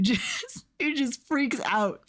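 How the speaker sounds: normal talking voice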